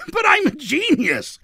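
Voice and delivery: high, triumphant exclamation; boastful outburst. Acted. Villain brushes off catastrophe, boastful outburst. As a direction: burst out with giddy self-regard.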